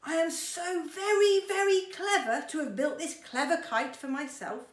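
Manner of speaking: loud, high, showing-off boast; Mister Clever boasting loudly to his friend. A narrator's reading. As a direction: brag about the kite, chest puffed out. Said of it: "boasted Mister Clever".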